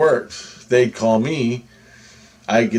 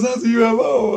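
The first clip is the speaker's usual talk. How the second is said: mock excited claim, much higher; imitating excited friend; 13 semitones higher than usual. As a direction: friend sure he's seen a UFO, retold. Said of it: "So he's freaking out".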